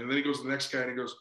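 ordinary delivery

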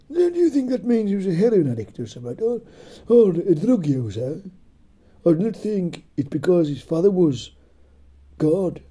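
high, snooty heckler; mocking listener voice. Voicing a heckler.

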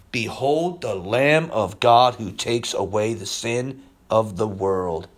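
slow, low, reverent reading; solemn proclamation. Retold or imitated. Preacher voicing John the Baptist, slow reverent proclamation.

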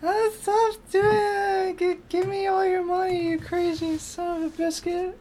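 very high, loud, shrill falsetto impression; impersonation. Shown as a demonstration. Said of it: "Mimic someone without revealing who".

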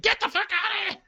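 very high, strained imitation of a yell; comic high-pitched outburst; mock angry yell. Everyone yelling him out, acted.

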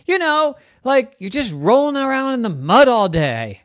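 high, eager little pig calling her friends; Dora the pig voice. Acted. Pig Dora calling to her bored farm friends, high and eager. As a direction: squeal it out to get everyone's attention.

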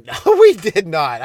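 loud, slow laughing no; laughing denial. Real, not acted. Comedian laughing off his own joke, loud and slow. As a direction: burst out laughing as you take it back. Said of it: "Yeah, we hurt her fairly badly".

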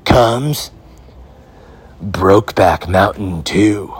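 slow, dramatic trailer announcer; movie trailer voice. Pretend. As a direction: sell the sequel like a cinema trailer.

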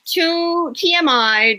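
slow, high, spelled-out protest; exasperated. A narrator's reading. Rachel cutting off Damien's overshare, slow exasperated protest. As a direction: stop him mid-overshare.

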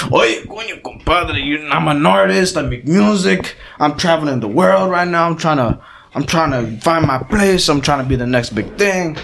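imitated roommate. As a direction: parody the aspiring artist's eager pitch. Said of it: "He goes up to me".

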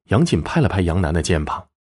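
ordinary delivery, nothing marked

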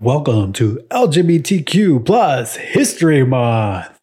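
big, drawn-out game-show host welcome; Ryan Seacrest. Acted. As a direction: announce it loud and slow, like a TV host. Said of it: "like, my Ryan Seacrest voice".

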